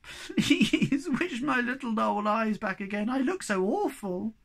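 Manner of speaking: high, slow, wailing plea; hammed-up tearful begging; crying out. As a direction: wail it pleadingly, drawing out the words. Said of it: "cried the Snork Maiden".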